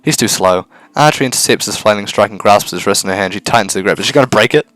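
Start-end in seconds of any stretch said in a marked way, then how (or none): none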